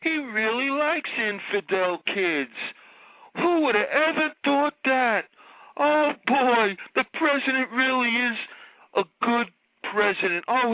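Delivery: high, gushing fake adoration dripping with sarcasm; sarcastic mock praise. Caller mocking the president on talk radio, sarcastic gushing. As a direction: pour on the phony love, sneering underneath. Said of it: "that Brooklynese, uh, Celente, uh, style".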